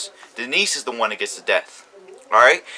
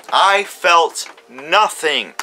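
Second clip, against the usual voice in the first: very slow, each word spaced out: I felt nothing; emphatic repeat; 3 semitones higher, 3 dB louder, much slower than usual. Real, not acted. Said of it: "repeats it for emphasis".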